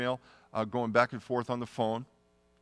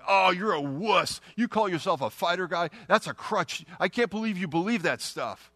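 higher, louder scornful taunt; higher sneering put-down; mocking scoff; 6 semitones higher, 4 dB louder than usual. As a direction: jeer at the believer like a cocky pilot. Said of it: "he'd always end it the same way".

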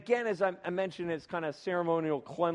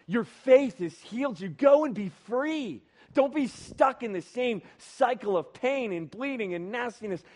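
higher, earnest, building rush of compassion; loving urging; 4 semitones higher, 4 dB louder than usual. Retold or imitated. Jesus freeing the woman as his daughter, fervent and loving. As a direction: urge her on fervently, easing down on the last words. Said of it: "he embraces her as a daughter".

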